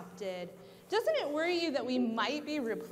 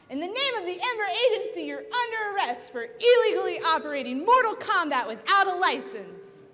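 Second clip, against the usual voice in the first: loud, fast proclamation; 5 semitones higher, 8 dB louder, faster than usual. Acted. Agent formally arrests villain.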